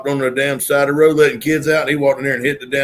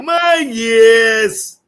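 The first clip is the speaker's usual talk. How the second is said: high, louder drawn-out "My yes"; Southern accent; 7 semitones higher, 3 dB louder than usual. Retold or imitated. Mocking his friend's deep Southern accent, higher and louder. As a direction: imitate a fired-up Southern friend. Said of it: "the accent ever gets that deep".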